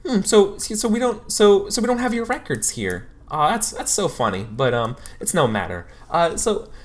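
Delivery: bright and probing; chirpy counselor. Acted. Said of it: "she says".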